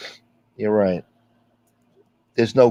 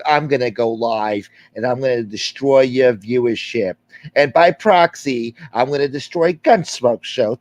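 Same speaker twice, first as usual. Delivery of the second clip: mocking the rival streamer; 2 semitones higher, 4 dB louder than usual. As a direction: puff up and threaten him like a cocky rival. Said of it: "He's like".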